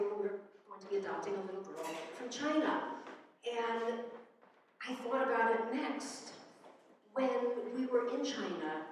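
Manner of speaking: ordinary delivery, nothing marked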